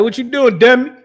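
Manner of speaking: high, loud teasing Baltimore call; Baltimore greeting. Retold or imitated. Mimicking how Baltimore folks greet a friend, high and loud. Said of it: "they call people dummies".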